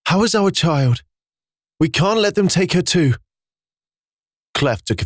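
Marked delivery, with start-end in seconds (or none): 0.1-3.1 high, breathless worried mother; exhausted mother fearing for her baby; exhausted and shaken. A narrator's reading. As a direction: gasp it out, barely holding together. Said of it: "with her entire body shaken due to exhaustion".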